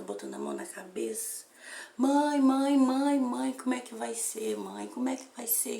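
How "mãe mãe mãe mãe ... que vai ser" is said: desperate daughter. Retold or imitated. Desperate daughter voiced in story.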